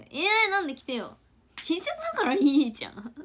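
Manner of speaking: loud high coaxing; pleading. Real, not acted. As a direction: talk her into wearing it. Urging friend to wear it. (高く大きな声でねだるように)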